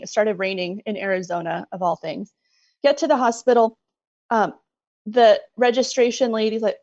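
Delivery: ordinary talk, nothing marked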